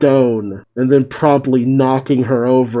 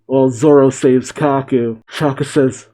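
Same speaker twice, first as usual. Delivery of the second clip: plain reading voice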